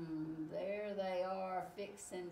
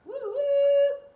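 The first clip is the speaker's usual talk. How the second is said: high, very loud woo-woo; blasting imitation of a train's whistle; 18 dB louder than usual. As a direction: hoot like a steam train whistle. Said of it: "He's making all sorts of train sounds".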